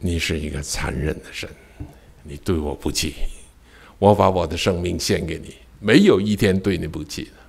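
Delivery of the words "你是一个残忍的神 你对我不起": quiet reflective. Shown as a demonstration. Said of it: "repeated softly in reflection".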